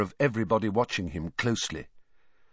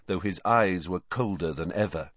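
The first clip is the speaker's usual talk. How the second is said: plain reading voice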